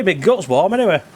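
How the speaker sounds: usual speaking voice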